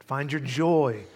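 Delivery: ordinary delivery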